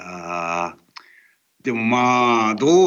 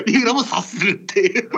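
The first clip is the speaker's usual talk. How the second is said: high voice breaking into laughter; laughing; 7 semitones higher than usual. Real, not acted. Host joking about co-host sensing cues, laughing. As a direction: say it through rising amusement.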